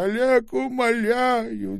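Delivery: put-on old man begging Oleg to stay, high and loud; pleading cry. A narrator's reading. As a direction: call after him, begging, slowly. Old man cries after Oleg.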